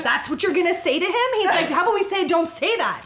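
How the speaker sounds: high, loud mock scolding; imitated indignant husband. Husband correcting wife's reply to their son, retold indignantly. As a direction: mimic an exasperated parent correcting someone.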